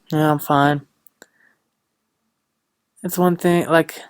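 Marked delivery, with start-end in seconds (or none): 0.1-0.8 unenthusiastic fine. Retold or imitated. Mocking how people answer at the store, unenthusiastic. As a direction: toss off a lifeless "I'm fine". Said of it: "as fine".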